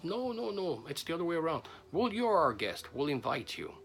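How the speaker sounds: high, emphatic protest; hospitable insisting. Acted. Host insisting his visiting friend be the guest, emphatic protest.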